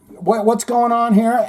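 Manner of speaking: a cop boarding and taking charge; demanding cop. Retold or imitated. Cop stepping onto the bus to ask what happened, loud demanding question.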